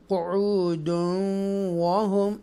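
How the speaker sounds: slow chanted tajweed reading; measured chanted recitation; Quranic recitation. Shown as a demonstration. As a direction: recite it slowly and melodically. Recites verse in lesson.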